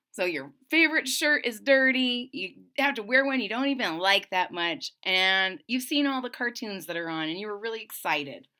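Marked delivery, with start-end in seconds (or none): none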